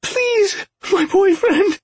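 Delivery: high, desperate and breathless; breathless panic. A narrator's reading. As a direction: plead in terror, out of breath. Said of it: "She was out of breath".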